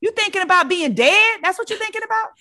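an auntie going off on her niece, pitch climbing; outraged auntie. Retold or imitated. Auntie scolds niece, story.

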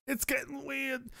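high, small, slow worry; soft high voice. Acted. Streamer fretting comically about a creepy book, soft high voice. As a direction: say it softly in a high put-on voice.

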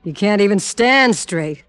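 laughing and taunting; gloating laugh through the words; mocking laughter. Villain mocks the drugged detective.

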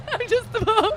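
repeats it through real laughter; laughing. Real, not acted. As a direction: laugh the words out, amused. Host repeats guest's line laughing.

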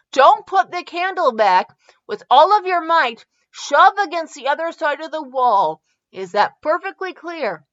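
squeezed against the wall, he orders her carefully. Acted. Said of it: "Now listen to me very carefully".

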